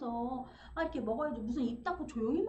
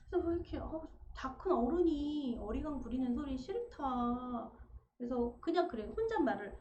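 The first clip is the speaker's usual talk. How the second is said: ordinary delivery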